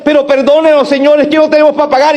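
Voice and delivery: pleading debtor. Acted.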